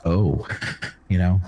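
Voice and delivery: ordinary talk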